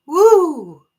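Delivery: a sudden high, loud woo of chills; excited whoop. Real, not acted. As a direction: let the shiver burst out as a whoop. Chills from a spirit message.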